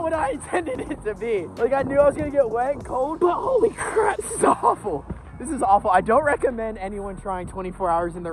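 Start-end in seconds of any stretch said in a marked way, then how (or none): 3.2-5.0 exasperated. Real, not acted. Complains, high.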